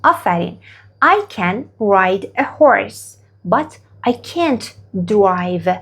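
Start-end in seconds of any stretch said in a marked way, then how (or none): 1.0-5.7 very slow, each word spaced out for learners; slow model sentence. Shown as a demonstration. Teacher modelling a sentence very slowly for students. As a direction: say it slowly so students can repeat. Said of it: "حالا شما به من بگید".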